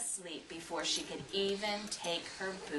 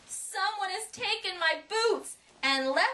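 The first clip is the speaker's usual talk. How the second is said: put-on Baby Bear voice; 10 semitones higher than usual. A narrator's reading.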